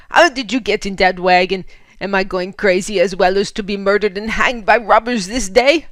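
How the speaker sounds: loud bewildered German voice; shouting in disbelief. Acted. Fritz cannot believe Lena was in his wagon, loud. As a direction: shout in confusion. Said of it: "he shouted".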